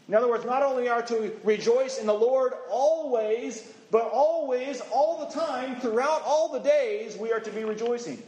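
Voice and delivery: mounting fervent emphasis; building emphasis. Acted. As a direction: preach it up, climbing with each phrase. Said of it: "not enough emphasis".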